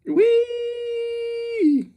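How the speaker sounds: squeaky high Whee; high squeal. Acted. Playful squeal.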